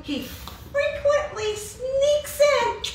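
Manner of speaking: high, drawn-out sneaking voice; sneaky Satan. Acted. Preacher showing how Satan slips through the back door, sneaky high voice. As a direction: creep in on a high, slow, put-on voice. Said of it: "like this".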